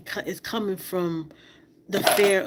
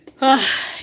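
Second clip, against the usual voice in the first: loud, falling sigh to steady oneself; heavy sigh; 5 semitones higher, 6 dB louder than usual. Real, not acted. Trying not to cry before carrying on, heavy loud sigh. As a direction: let out a big exhale against the tears. Steadying self against crying.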